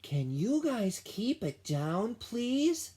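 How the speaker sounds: polite request. A narrator's reading. Ellie politely asking the noisy animals to hush. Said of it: "Ellie asked politely".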